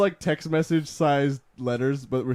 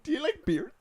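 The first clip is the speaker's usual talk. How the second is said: high mock quote; 12 semitones higher, 3 dB quieter than usual. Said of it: "It's like".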